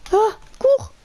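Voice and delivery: high panicked cry; panicked shout. Real, not acted. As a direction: yelp it in a panic. Fleeing bees.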